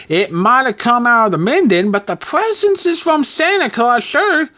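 high-pitched landlady voice; reluctant fond landlady. A narrator's reading. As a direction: answer high, coy about the gift. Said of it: "replied our landlady reluctantly, as she looked fondly".

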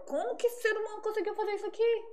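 high, incredulous private outburst; exasperated disbelief. Acted. Marvelling privately at someone's botched work, high-pitched exasperation. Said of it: "tem coisa que eu falo assim".

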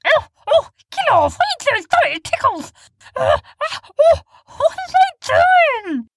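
very high, loud squeals of protest; being poked and tickled; squeaky giggly get off. Acted. Sniz tickled by Mora.

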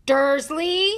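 loud, high roar of a name; booming shout. A narrator's reading. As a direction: boom it out furiously. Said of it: "he boomed".

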